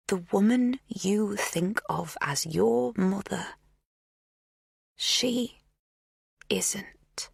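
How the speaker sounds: slow hushed reveal. A narrator's reading. Magda's slow revelation.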